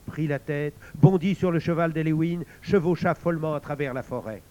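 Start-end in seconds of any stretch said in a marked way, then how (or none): none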